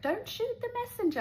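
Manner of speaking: sheepishly mock the friend; apologetic messenger. Friend calls with bad news, high sheepish voice. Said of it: "It's like".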